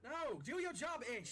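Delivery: high, loud order; fed-up deck-hand bellow; exasperated distant shout. Acted. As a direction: yell up at a slacking lookout. Shouting up to the crow's nest.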